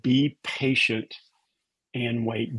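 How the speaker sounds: each word read slowly and separately; slow reverent reading. Retold or imitated. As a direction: weigh every word of the verse. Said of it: "I would read each word".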